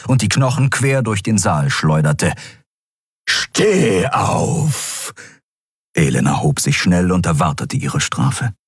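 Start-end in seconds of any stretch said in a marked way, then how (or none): 3.3-5.1 menacing, warped dark lord; distorted voice. Acted. Emperor commands. (verzerrte, tiefe Herrscherstimme)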